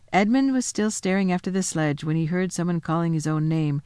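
ordinary delivery

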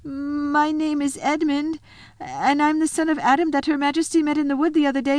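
put-on high, nervous boy gabbling his message; trembling. Edmund gives the wolf his message, trembling and high. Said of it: "trembling so that he could hardly speak".

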